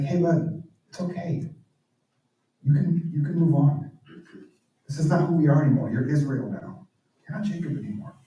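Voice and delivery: understated kind reassurance; gentle reassurance. Retold or imitated. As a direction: comfort him quietly, like a friend. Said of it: "to hear it like this".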